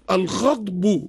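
الغضب with "a" after the ghayn, "al-ghadb"; fatha vowel. Shown as a demonstration. Scholar lists names for the lion, الغضب with fatha. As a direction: open the first syllable of الغضب to "ghad". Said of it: "بالفتح".

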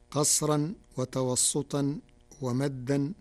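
usual speaking voice

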